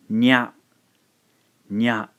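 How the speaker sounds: "nya" as one loud syllable; one clean beat for "nya"; blended nya. Shown as a demonstration. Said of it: "we end up with".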